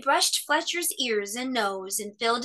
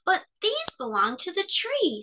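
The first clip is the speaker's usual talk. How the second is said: little bear; 9 semitones higher than usual. A narrator's reading. Cub protesting.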